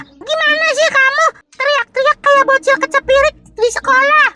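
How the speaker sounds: high-pitched mock scolding; imitating fierce woman. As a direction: mimic the neighbour telling her man off. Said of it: "yang cewek semalam galak banget".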